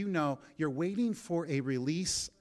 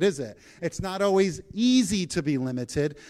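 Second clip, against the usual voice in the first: ordinary delivery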